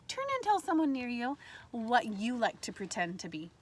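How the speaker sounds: ordinary delivery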